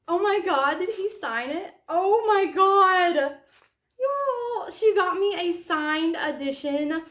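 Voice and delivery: gasp, then high, loud astonishment; real overwhelmed amazement. Real, not acted. Finding her gift is a signed edition, overwhelmed amazement.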